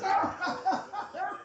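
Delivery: high acted belly laugh; laughing his ass off. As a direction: act out a viewer cracking up. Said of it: "He's laughing his ass off".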